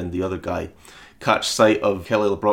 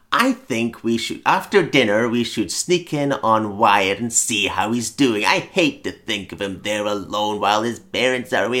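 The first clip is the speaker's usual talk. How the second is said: put-on ridiculous old people. Grandparents fretting over their grandson, lightly voiced as ridiculous old people. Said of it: "ridiculous kind of old people".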